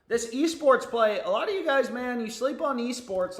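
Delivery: pumped-up esports pitch; excited. Real, not acted. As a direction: sell the esports pick with a burst of energy.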